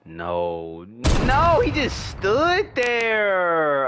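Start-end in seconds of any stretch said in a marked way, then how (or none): 1.2-3.9 dismayed wail. Real, not acted. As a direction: start high in disbelief, slowly deflate. Dismay at player standing still.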